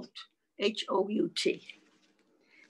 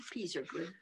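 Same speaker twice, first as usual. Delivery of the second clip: playful whisper; 4 semitones lower, 13 dB quieter, faster than usual. Shown as a demonstration.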